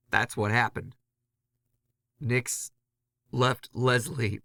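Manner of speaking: plain reading voice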